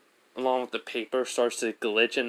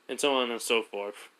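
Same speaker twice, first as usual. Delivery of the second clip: ordinary delivery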